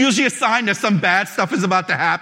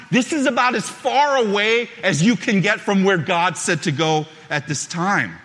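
normal talking voice

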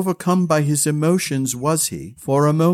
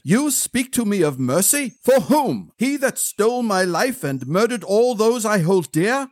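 higher, indignant retort; a flash of anger at the retainer; flaring temper; 7 semitones higher than usual. A narrator's reading. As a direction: throw the word mercy back at him. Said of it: "Brian's temper flared".

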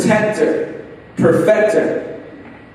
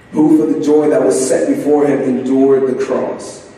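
solemn low preaching; 9 semitones lower than usual. Acted. Solemn low reflective appeal.

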